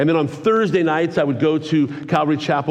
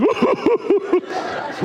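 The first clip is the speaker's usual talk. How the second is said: high boo-hoo wail; mock crying. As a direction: imitate bawling in high, pulsing sobs. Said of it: "I was still crying".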